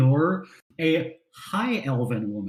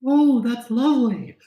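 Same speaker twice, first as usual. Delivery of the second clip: high delighted lady voice; high elf lady voice; 7 semitones higher, 4 dB louder than usual. Acted. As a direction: coo like a pleased noblewoman.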